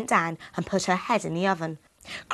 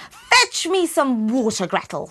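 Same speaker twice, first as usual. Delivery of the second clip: angry screech; 5 semitones higher, 6 dB louder than usual. Acted. The impatient witch screeches at Gretel, louder and higher. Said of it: "she screeched angrily".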